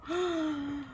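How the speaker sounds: soft story-time gasp; a small mock gasp. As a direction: gasp gently for the children. Reader reacts to story.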